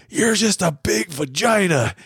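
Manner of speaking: put-on high, childish taunt; a small boy's mock taunt at his sister; kid's insult. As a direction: sneer it up high like a little kid. Kid insulting older sister.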